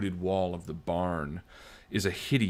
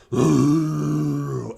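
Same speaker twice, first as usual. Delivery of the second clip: howl; 6 semitones higher, 8 dB louder than usual. A narrator's reading. Narrating the creature's howl.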